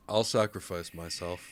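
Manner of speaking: low, soft character line; hushed, sombre self-sacrifice; quiet resolve. Acted. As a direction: offer it softly and low. Character offers own life.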